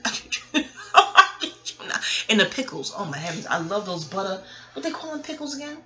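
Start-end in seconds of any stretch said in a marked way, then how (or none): 0.1-0.7 breathy laughing words; laughing through it. Real, not acted. Insisting to viewers between giggles. 0.9-2.0 higher, louder, slow and laughing; loud laughing insistence. Real, not acted. Swearing to viewers it's true, laughing loudly.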